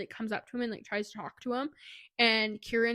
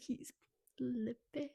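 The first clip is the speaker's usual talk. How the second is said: ordinary delivery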